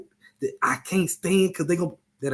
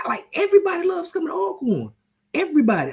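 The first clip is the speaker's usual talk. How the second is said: much higher, near falsetto; worked-up insistence. Real, not acted. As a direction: hammer it home, incredulous. Repeats the claim much higher.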